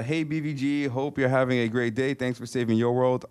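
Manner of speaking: ordinary talk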